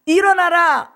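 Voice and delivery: loud, high call of God's voice; God's command. Retold or imitated. Preacher voicing God calling Abraham, loud and high. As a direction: cry out the command to rise.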